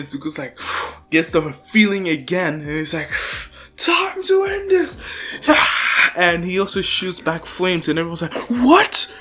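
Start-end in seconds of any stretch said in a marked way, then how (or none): 3.8-6.2 high, strained attack yell; battle cry. Retold or imitated. Izuku's attack cry retold. 8.5-9.1 high, loud disbelief; shocked exclamation. Retold or imitated. Shocked crowd exclaiming in retelling.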